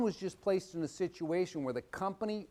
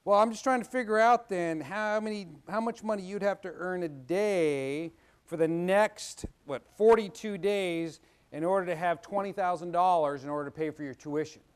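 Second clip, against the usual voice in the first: dad calculating; 4 semitones higher, 6 dB louder than usual. Retold or imitated. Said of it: "He goes".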